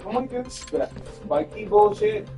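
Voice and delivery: ordinary talk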